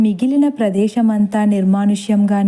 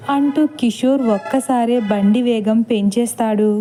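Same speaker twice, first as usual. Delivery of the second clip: usual speaking voice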